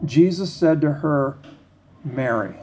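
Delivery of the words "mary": tender naming. Acted. Jesus speaks her name.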